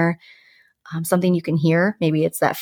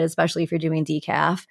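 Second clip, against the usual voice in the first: normal talking voice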